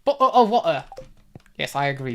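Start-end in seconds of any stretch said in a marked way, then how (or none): 0.0-0.8 hammed-up British "bottle of water"; playful British caricature; mock British accent. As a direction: put on British glottal stops. Mock British catchphrase after accent talk.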